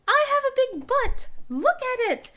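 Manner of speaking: high, loud goofy boast; silly kid voice. Retold or imitated. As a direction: squeal it like a cheeky kid. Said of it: "he kept just saying".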